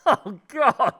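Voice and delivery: loud, high laughing cry; horrified laughter. Real, not acted. Co-host reacting to the finger story, horrified laugh. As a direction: burst out laughing in horror.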